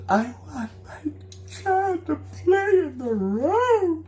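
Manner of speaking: high, slow mock sobbing voice; imitating her fake whimpering cry. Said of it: "the fake crying goes on again".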